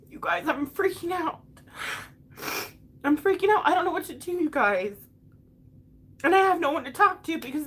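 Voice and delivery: tearful, high-pitched panic; freaking out through tears; real crying. Real, not acted. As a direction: voice pushed high by crying, overwhelmed. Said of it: "didn't mean to cry on here".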